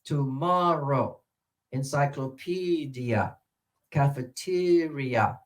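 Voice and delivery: slow syllables, stressed one stretched in "tomorrow, encyclopedia, cafeteria"; demonstrated word stress. Shown as a demonstration. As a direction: draw out "MOR", "PE" and "TE", then let each word fall. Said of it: "she still uses this".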